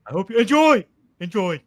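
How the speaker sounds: high, loud puppet frog voice; Kermit the Frog voice. Retold or imitated. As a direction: squeak it out like Kermit. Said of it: "it's Kermit the Frog's voice".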